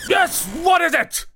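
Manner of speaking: grumpy shout at the door. Acted. Scrooge calls to the door.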